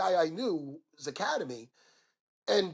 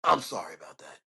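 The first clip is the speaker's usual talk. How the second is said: low, hushed mock-sheepish apology; 13 semitones lower, 7 dB quieter than usual. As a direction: drop to a near-whisper, acting out an apology.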